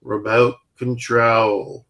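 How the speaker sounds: very slow, stretched mock Australian delivery; bad Australian accent. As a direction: drawl it in an imitated Aussie accent. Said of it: "I don't do a good Australian accent".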